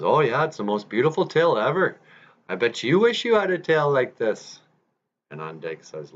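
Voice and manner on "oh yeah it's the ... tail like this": high, taunting brag; boastful beaver. Acted. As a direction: lord it over the bear, pitch lifted. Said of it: "And on Mick goes".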